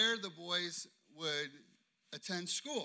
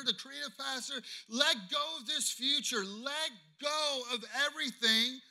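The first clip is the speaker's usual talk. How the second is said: loud, high, insistent preaching; forceful repeated charge from the pulpit; God commanding; 7 semitones higher, 6 dB louder than usual. Retold or imitated. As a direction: hammer out each command as God's voice. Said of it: "all I had was God going".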